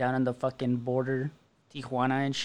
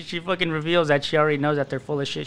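ordinary delivery

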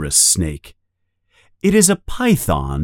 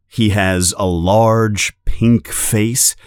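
plain reading voice

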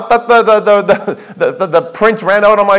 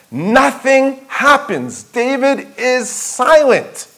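slow and grave; 5 semitones higher, much slower than usual. Acted. Stressing David's inaction.

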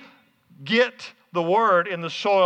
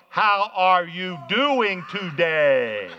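exaggerated word-by-word slowness; really slow; much slower than usual. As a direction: stretch every word for someone hard of hearing. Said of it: "and really slow".

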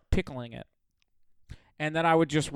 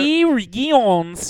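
silly high Dragons; 8 semitones higher, 6 dB louder than usual. Acted. Mocking the word.

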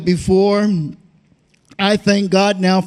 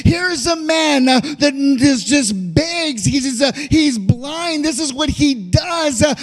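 fervent, raised preaching cadence; preaching at full force; 6 semitones higher than usual. Acted. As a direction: preach it out with a hoarse-free swell. Preacher building fervor.

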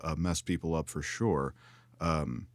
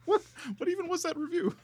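high, stunned what; incredulous. Real, not acted. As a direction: squeak out disbelief. Stunned by the review.

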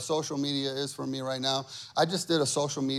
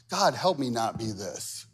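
usual speaking voice

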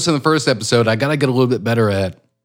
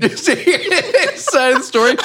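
laughing disbelief; 10 semitones higher than usual. Real, not acted. Incredulous laughing echo.

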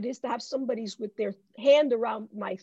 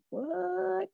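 baffled what; 5 semitones higher, 8 dB quieter than usual. Retold or imitated. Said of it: "Habakkuk, I'm sure, goes".

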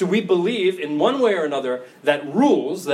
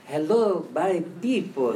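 lightly voiced elderly Pope with slow accented English; a hint of a cute old man greeting the crowd; Pope Francis; 4 dB quieter, slower than usual. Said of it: "he's like".

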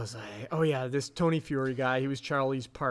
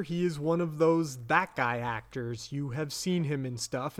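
ordinary talk, nothing marked

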